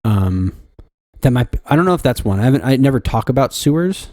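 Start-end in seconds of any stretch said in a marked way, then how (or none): none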